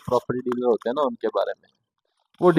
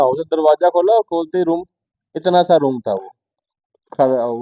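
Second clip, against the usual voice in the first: ordinary talk, nothing marked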